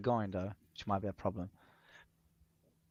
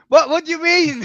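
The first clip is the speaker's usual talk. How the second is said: surprised; 12 semitones higher, 8 dB louder than usual. Real, not acted. Reacting to punishment.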